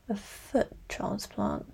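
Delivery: very soft, slow trailing off. A narrator's reading. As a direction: let it fade out softly. Trailing quiet joke.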